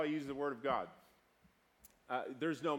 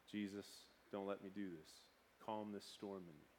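low, hushed prayer; quiet plea; 6 semitones lower, 12 dB quieter than usual. Retold or imitated. Angry man asking Jesus to calm his storm, hushed. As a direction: plead softly, well under your usual voice.

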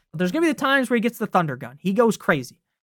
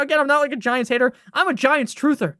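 ordinary talk, nothing marked

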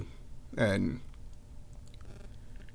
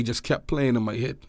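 usual speaking voice

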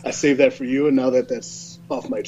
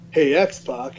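ordinary talk

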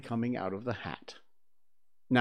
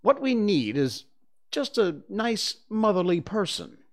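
put-on know-it-all boy, higher and loud; confident boy voice, higher; Peter's voice, high; 9 semitones higher, 5 dB louder than usual. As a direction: Peter as the expert, pitched up.

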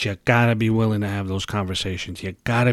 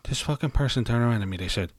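ordinary talk, nothing marked

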